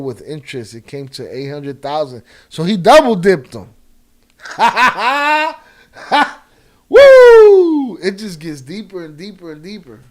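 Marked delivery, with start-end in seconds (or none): 4.4-5.6 burst of laughter. Real, not acted. Laugh at the story. 6.9-8.0 high shouted woo of disbelief; loud whoop. Real, not acted. Stunned whoop.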